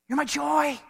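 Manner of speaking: lifted, heartfelt voice of Paul, a touch acted; Paul's tender joy. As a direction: say it like a fond pastor to his flock. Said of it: "But Paul's like".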